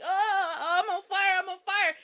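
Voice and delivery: high-pitched hammed-up distress; mock panic. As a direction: play a burning man crying out in a high voice. Acting out man running on fire.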